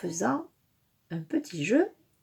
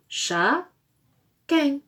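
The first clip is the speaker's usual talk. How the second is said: "Chat", pause, "Quin", each syllable alone; rising "Chat", a gap, then falling "Quin"; split into syllables; 4 semitones higher than usual. Shown as a demonstration. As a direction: say the word in two separate pieces. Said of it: "Dernière syllabe de requin".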